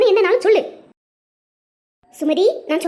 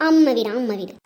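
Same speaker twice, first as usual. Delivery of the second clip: low, disdainful repetition; scornful grumble; 8 semitones lower than usual. Acted. Mother-in-law sneering at the wife's visit home, scornful. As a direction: grumble it low, mocking the words.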